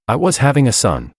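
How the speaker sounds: ordinary delivery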